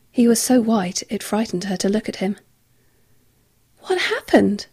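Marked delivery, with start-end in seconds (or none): 3.8-4.7 gasped. A narrator's reading. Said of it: "she gasped".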